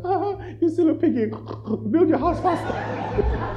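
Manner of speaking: high teasing laugh; mocking laugh. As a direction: jeer like a cheeky piglet. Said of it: "were laughing at him".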